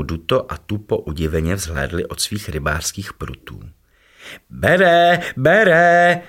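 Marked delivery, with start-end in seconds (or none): 4.5-6.2 much higher, louder shout; excited calling. A narrator's reading. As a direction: yell that the fish is biting. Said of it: "volal pro změnu Tupo". (hlasité vzrušené volání, vysoko)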